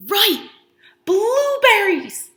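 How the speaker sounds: high, loud and slow "Blueberries!"; delighted teacher exclaiming; excited praise. Real, not acted. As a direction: cheer the answer like a reveal.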